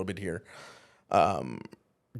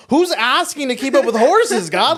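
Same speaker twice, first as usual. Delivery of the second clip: much higher and louder, in disbelief; exasperated retort; 10 dB louder than usual. Retold or imitated. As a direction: throw it back at God, fed up.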